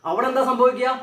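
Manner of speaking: ordinary delivery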